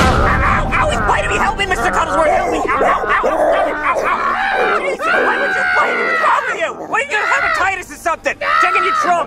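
high yelps of pain while being bitten; pained yelling. Acted. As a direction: cry out, the dog won't let go. Dog bites him, he cries out.

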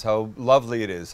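ordinary talk

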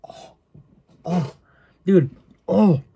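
sudden pained cry from a sharp tooth. Real, not acted. Apple string flossing his tooth to the gum, pained cry. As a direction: yelp as the core string cuts the gum. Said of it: "stringy piece flossed my tooth".

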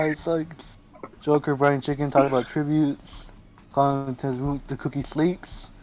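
ordinary delivery

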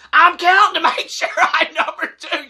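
high-pitched, flustered protest; acted-out nervous excuse with a laugh breaking in; panicky defence. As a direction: protest in a squeaky mock panic.

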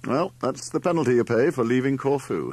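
scornful retort. A narrator's reading. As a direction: point it out with a scoff. Larry snorts and scoffs at Mother.

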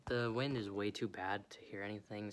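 ordinary delivery

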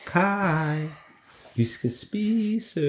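high, slow, drawn-out call from afar; mother calling. A narrator's reading. Mum calls Kaj home for dinner, high and drawn out. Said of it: "som lød sådan her".

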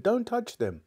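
high-pitched urgent cry; shouted warning. A narrator's reading. Diggory stops Polly touching the rings, shouting. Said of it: "he shouted".

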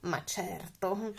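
soft, slow dragon reply; lightly voiced self-assured dragon; boastful dragon. A narrator's reading. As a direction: drawl it softly, sure of yourself. Dragon boasts of his powers.

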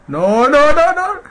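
rising to a very high, loud scream; shrieking in panic; terrified screaming no. Real, not acted. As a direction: scream no as it grabs you. Monster catches him.

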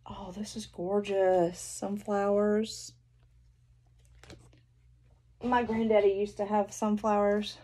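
ordinary talk

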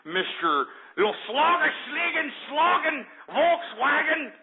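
high, slow, drawn-out mock German; mock German name. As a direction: stretch out each fake German word derisively.

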